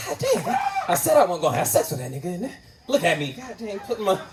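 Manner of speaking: very high, fast laughing rant; laughing at himself. Retold or imitated. Scolding himself for breaking his vow, high and laughing. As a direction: crack up at your own foolishness.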